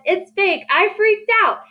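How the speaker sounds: high-pitched example of freaking out; mock exclamation. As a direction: exclaim it high, without shouting. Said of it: "that's what needs exclamation".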